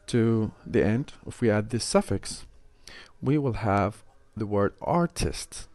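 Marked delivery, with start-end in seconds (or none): 4.8-5.7 "artist" stressed on ART; stress on first syllable. Shown as a demonstration. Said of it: "stress will be kept on the, the original word art".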